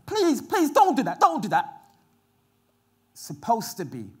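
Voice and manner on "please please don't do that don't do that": high, loud desperate no; frantic shout. Retold or imitated. Lot shouting at the crowd outside, frantic plea. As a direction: plead at the top of the lungs. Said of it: "So Lot turns around and says".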